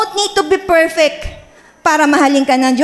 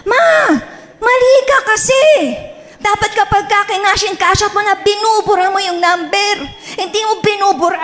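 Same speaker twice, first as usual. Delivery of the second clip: higher-pitched scolding that turns to a shout at the end; angry scolding; 5 semitones higher than usual. Retold or imitated. Pastor reenacting scolding her mother, angry and high. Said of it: "galit na galit po ako sa nanay ko".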